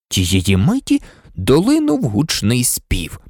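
usual speaking voice

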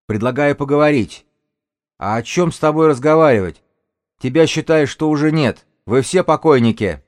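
loud, high, hostile shouting; shouted exchange. Acted. Enemies parley and threaten across the yard, loud high shouts. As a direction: hurl it across the distance.

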